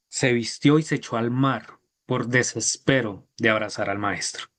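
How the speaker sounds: ordinary delivery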